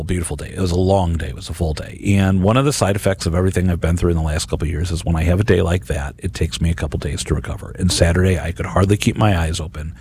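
ordinary delivery

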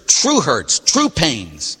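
ordinary delivery

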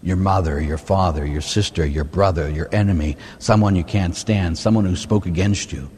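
ordinary talk

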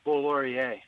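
"Boulaurier" said plainly, ending in "ee-ay"; matter-of-fact "Boulaurier" with an "ay" ending; surname pronunciation. Shown as a demonstration. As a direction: show how "Boulaurier" is said, ending "ree-ay". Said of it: "how do you say your last name".

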